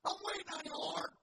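loud, high hammed-up plea to heaven; crying out. Flood victim on his porch calling to the Lord, crying out. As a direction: holler it way up high, like a stranded man praying. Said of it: "he's crying out to the Lord".

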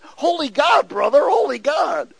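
high, loud insistent reply; emphatic correction. Retold or imitated. Preacher correcting the cussing biker, loud and high. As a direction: drive it home over his cussing. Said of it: "I said".

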